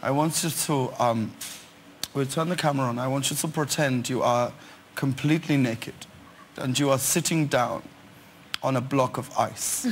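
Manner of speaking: low-pitched, softly spoken director; low voice, measured pauses between phrases; commercial director impression. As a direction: imitate a director calmly giving absurd instructions. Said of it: "And he spoke like this".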